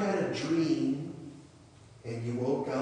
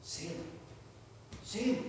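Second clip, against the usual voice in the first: hushed, higher calling of a name; soft mysterious call; 6 semitones higher, 7 dB quieter than usual. Acted. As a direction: call softly, like a voice in the night. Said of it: "he hears this voice that says".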